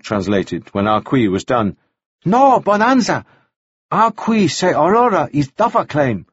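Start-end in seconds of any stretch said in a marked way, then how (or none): 2.2-6.3 high-pitched halting interpreter; Arsuk, translating. A narrator's reading. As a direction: report it in a high, halting character voice.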